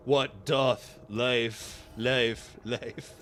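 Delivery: very slow, fading ponder; mock profound musing. Quoting a cartoon monologue, very slow and fading. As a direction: drag it out like a deep thought.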